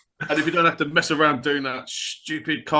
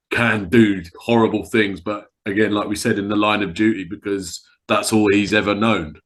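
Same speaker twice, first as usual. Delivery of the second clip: ordinary talk, nothing marked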